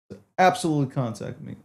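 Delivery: normal talking voice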